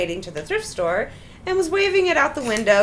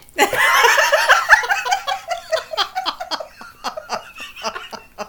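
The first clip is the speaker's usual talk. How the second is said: long squealing laughter; helpless laughing fit; long high laugh. Real, not acted.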